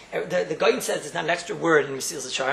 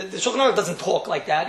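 ordinary talk

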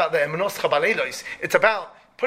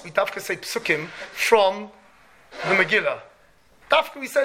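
ordinary talk, nothing marked

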